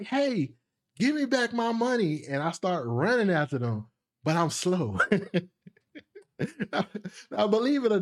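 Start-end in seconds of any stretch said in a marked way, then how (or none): 0.0-2.2 high, half-voiced cry of protest; retold childish protest. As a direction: imitate a kid calling after thieves. Robbed child calls after thieves.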